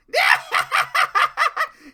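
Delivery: loud, high mocking cackle; high-pitched mock evil laughter; mean cackle. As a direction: burst into a villain's gleeful laugh. Mean twin laughs at the bird.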